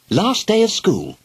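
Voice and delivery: put-on bright, high little-girl voice; happy it's the last day; pleased. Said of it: "said Helen in a pleased voice".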